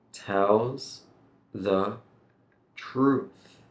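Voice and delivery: tells... the... truth, with pauses between words; slow, word by word. Shown as a demonstration. Teacher says go slowly here, slow separated words. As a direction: separate each word carefully. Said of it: "You can go slowly here".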